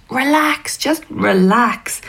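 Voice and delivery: slow, drawn-out soothing; calm her down slowly, a little creepy. A narrator's reading. Older writer calms the nervous young writer, slow and soothing.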